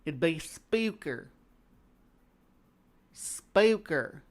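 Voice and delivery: Spooker said with a drawn-out ew vowel. Shown as a demonstration. Local correcting the narrator, Spooker with a long ew. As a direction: slowly stretch Spooker into Spew-ker. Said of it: "You gotta get that ew in there".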